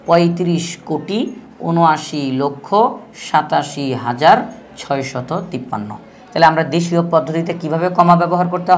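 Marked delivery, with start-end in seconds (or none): 0.1-5.9 each number group said slowly, with pauses between; slow demo reading. Shown as a demonstration. Teacher slowly reads the number aloud to the class. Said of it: "কোটি চলে আসছে".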